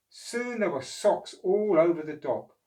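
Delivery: put-on lively read-aloud voice for children; storybook narration. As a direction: tell it like a bedtime picture book.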